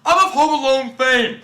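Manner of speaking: high, loud, drawn-out proclamation; slow, puffed-up mock boast; mock boastful actor. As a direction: announce it like a washed-up star. Mocking actor announcing his fame.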